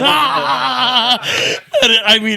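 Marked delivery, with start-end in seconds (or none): 0.0-1.2 high, drawn-out mock cackling cry; long high-pitched imitation whoop; imitated comic's yell. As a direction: wail it out high like a hyped comic. Imitating comic's cry after punchline.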